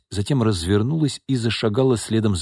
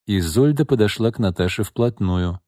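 plain reading voice

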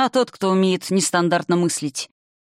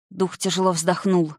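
ordinary delivery, nothing marked

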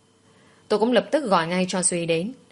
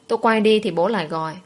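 usual speaking voice

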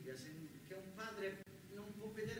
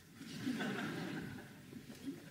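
laugh; 4 semitones higher, 5 dB louder than usual. Real, not acted. Bursts out laughing mid-story.

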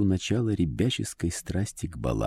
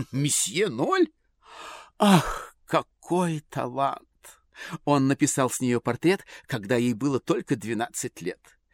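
society lady voice; 7 semitones higher, 3 dB louder than usual. A narrator's reading. Lady insists on portrait.

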